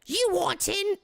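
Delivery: put-on high squeaky old woman; old lady voice. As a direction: play the old lady guard. Voicing old lady guard.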